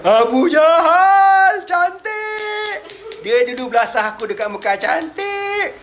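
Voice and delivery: high, drawn-out triumphant cry; gleeful gloating. Acted. Abdullah gloats over Abu Jahal. (sorakan gembira membalas dendam)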